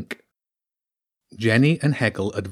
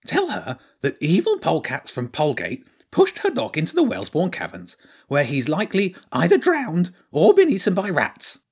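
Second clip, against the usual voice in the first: high hedgehog voice, bluntly explaining; matter-of-fact; 7 semitones higher than usual. A narrator's reading. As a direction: deliver grim news as a simple fact. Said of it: "in a rather matter-of-fact tone".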